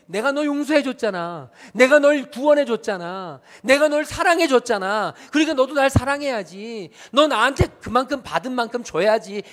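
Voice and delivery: an imagined Jesus demanding love back, loudly. Retold or imitated. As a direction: claim what is owed, loud. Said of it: "이렇게 물으시는 걸로 착각".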